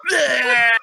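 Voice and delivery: loud, high retching bleat; horrible puking noise. Retold or imitated. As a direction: demonstrate the awful vomit sound. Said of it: "a horrible noise like that".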